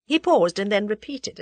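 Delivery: ordinary delivery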